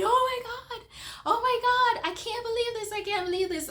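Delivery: very high, ecstatic cry; excited screaming. Retold or imitated. Running around after the miracle, screaming with excitement. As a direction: squeal it high and loud, easing slightly. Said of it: "running around screaming, so excited".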